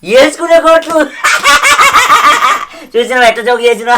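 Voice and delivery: high, loud cackle at his own pun; laughing at own joke. Acted. Drunk husband laughing loudly at his own pun. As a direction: crack up at your own joke.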